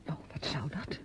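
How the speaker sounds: hushed unvoiced aside; whisper. Niece whispering to her aunt about the man in the hall. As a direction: breathe it so only the aunt hears. Niece dismissing aunt's worry.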